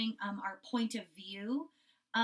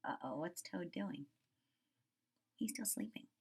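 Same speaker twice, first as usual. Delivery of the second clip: hushed aside; 6 semitones lower, 11 dB quieter than usual. Real, not acted. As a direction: whisper so Toad sleeps on. Aside to kids about sleeping.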